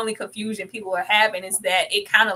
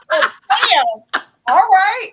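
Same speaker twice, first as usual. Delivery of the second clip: much higher, louder stunned reaction; shocked; 11 semitones higher, 11 dB louder, much slower than usual. Retold or imitated. Retelling her own reaction to the scene, high loud astonishment. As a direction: gasp it out amazed. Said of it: "I was like".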